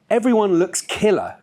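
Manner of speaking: high, lightly exclaimed delight; excited cry. A narrator's reading. Simone admiring the goth crowd to her friend, an excited cry. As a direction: cry it out with enthusiasm, pitched well up. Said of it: "cried Simone".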